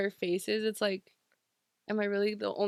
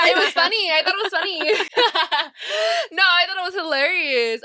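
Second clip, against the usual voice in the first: higher, louder, laughing through the words; real laughter, higher and louder; 7 semitones higher, 7 dB louder than usual. Real, not acted. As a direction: giggle while insisting it was funny. Laughing at her own story.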